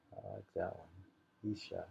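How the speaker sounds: normal talking voice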